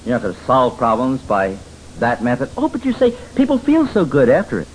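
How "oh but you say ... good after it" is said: mock objector. Voicing an objector's claim.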